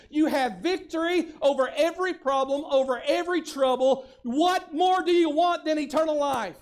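loud, high, ringing sermon voice; full-force preaching. Acted. Pastor preaching full force to his congregation. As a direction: preach it out to the whole church.

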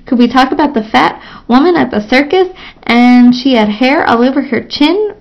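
usual speaking voice

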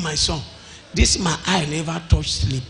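normal talking voice

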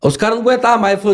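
normal talking voice

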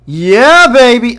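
high, loud whoop of delight; excited cheer. Real, not acted. Cheers at the game item.

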